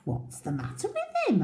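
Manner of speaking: high, quick deer voice; a curious deer asking. A narrator's reading. Deer asking the others about the crocodile, high. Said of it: "asked a deer".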